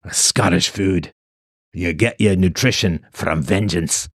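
usual speaking voice

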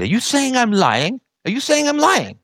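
high, shrill-pitched mock outrage rising on the repeat; a hammed-up are-you-saying-I'm-lying; imitated angry accusation. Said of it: "I remember that flash of anger".

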